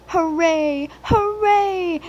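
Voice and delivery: high, loud joyful cheer, climbing; whistled cheer. A narrator's reading. The engines cheer the England trip, high excited whistling. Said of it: "the engines whistled".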